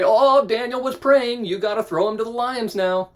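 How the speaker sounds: put-on tattle to the king like a snitching kid; hammed-up whiny tattler; tattling. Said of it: "they run and tattle to the king".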